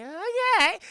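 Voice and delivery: high, loud startled okay; mock startled mom. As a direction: yelp it out in surprise. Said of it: "she's like".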